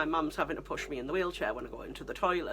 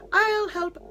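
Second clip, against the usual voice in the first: much higher, louder mock offer; over-eager helper; 13 semitones higher, 7 dB louder than usual. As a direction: imitate a stranger chirping an offer of help. Imitating an over-eager helpful stranger.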